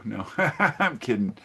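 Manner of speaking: ordinary talk, nothing marked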